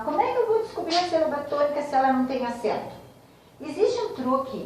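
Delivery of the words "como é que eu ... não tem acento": quick, higher voice of a confused student; puzzled student. Retold or imitated. Teacher imitating a confused pupil, quick and higher. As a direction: rattle off the student's worried question. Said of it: "muitas vezes o aluno questiona".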